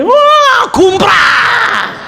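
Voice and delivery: very high hammed-up shriek; mock screaming refusal. Girl refusing the marriage by screaming, mock shrieking tantrum. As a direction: shriek it like a bride throwing a tantrum. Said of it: "kalau nangisnya sambil teriak-teriak".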